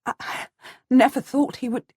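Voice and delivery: high, joyful stammer of relief. A narrator's reading. Mother hears her son may return, stammering with joy. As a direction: stumble in, then lift high with relief. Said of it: "I am stammering in my joy and relief".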